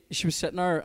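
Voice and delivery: ordinary talk, nothing marked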